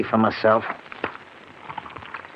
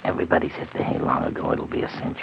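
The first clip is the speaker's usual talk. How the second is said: low, soft whisper; whispering to his partner before the break-in; 8 semitones lower, 6 dB quieter than usual. Acted. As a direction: hush it, we're at the house. Whispering at the house to rob.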